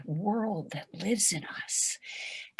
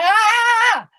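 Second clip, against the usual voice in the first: high, loud held "ah"; plunging scream; 15 dB louder than usual. Acted. Pushed diver at that moment, high loud scream. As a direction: yell it as you hit the moment. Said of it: "It's that moment".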